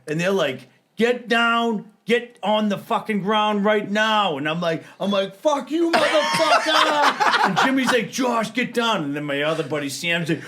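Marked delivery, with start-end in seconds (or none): 1.0-4.4 louder, slow barked command; police order. Retold or imitated. Cops ordering him down. 5.3-7.3 high, drawn-out laughing curse; drunken defiance. Retold or imitated. Defying the cops drunk. 8.1-9.0 higher, laughing call to come down; friend urging. Retold or imitated. Friend pleading him down.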